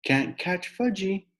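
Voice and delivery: high, slow singsong taunt; taunting toddler. Acted. Fudge taunting the kids, high singsong. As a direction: tease like a little kid running off.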